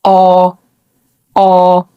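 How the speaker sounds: "آه" with the mouth wide open; open ah sound. Shown as a demonstration. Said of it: "فبنقول". (صوت آه مفتوح)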